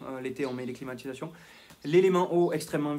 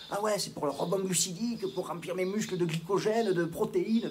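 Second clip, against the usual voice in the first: mocking gym buff; 4 semitones higher than usual. Mocking a gym-goer's jargon.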